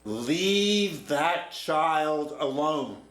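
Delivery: loud, very slow, stern stranger's order; stern command. Retold or imitated. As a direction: lay down the law to the counselor, slowly. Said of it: "And he says".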